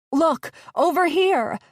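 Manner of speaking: high, loud scream. A narrator's reading. Gabby screaming over the motor noise, high loud. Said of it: "Gabby screamed over and over".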